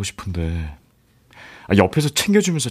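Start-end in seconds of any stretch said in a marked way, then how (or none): none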